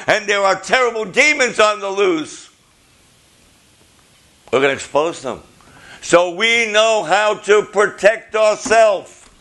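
loud, high preacher's cry; preaching. Acted. Preacher warning the congregation about demons, full force. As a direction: preach it out to the church.

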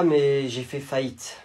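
ordinary talk, nothing marked

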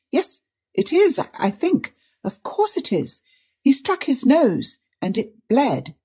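high, flustered, halting explanation; stammering. A narrator's reading. Niece explaining the blood to her aunt, stammering. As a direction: explain it away in a high, nervous voice. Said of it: "she stammered".

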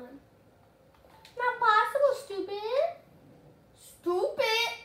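high, strained yelps of frustration; frustrated squeal. Real, not acted. Streamer squealing at bad loot in a chest.

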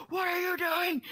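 high-pitched mock cry of alarm; imitating alarmed coworker. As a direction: call out as the startled coworker. Retold alarmed coworker catches her lifting.

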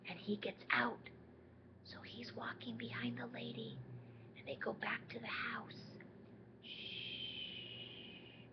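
soft sneaky whisper; whispered storytelling. A narrator's reading. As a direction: whisper like sneaking past someone asleep. Sneaky gorilla part whispered.

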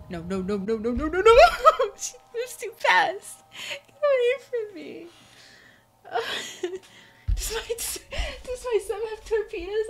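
very high, shrill panic through laughter; panicked laughing scream. Real, not acted. Player fleeing the squid girl, panicked high laughter. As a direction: scream and laugh in terror as it chases you.

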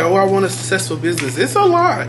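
loud mock wish, then a burst; mock wishful voice. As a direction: imitate everyone's dreamy wish. Mocking everybody, then exclaiming.

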